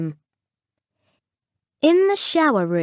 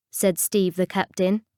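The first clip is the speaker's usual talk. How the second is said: plain reading voice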